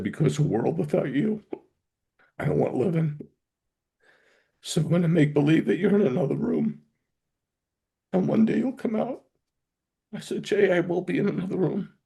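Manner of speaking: high, choked, real tearful voice. Real, not acted. Father repeating his son's grieving reply, tearful. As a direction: struggle through the words, close to crying. Retelling son's grief at goodbye.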